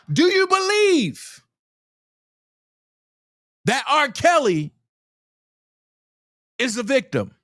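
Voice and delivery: high insistent question; high pointed demand to the lawyer; high challenge. Real, not acted. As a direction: challenge him directly. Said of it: "confronting the lawyer".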